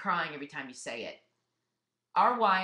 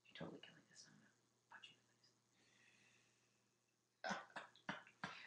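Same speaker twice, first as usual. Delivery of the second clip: joking whispered threat, barely voiced; playful whisper; 23 dB quieter than usual. Real, not acted. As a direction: whisper a mock threat to her husband. Teasing aside to husband Lee.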